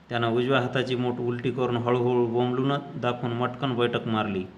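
plain reading voice

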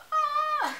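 high overwhelmed squeal; squeal, overcome with feeling; emotional squeal. Real, not acted. Emotional reaction to a video.

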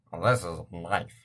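slow, badly faked Australian; bad Australian accent. Gamer failing at an Australian accent. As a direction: attempt a clumsy Australian drawl. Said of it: "I can't do an Australian accent".

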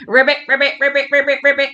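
string of quick ribbits; frog noises. Shown as a demonstration. Joining the children's frog noises, quick croaks. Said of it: "Let's hear your frog noises".

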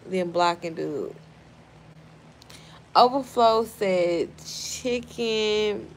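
usual speaking voice

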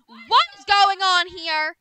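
slow, drawn-out loud demand; mother catching them again; angry shout. Acted. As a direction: stretch it out, outraged. Mother finds them at beach.

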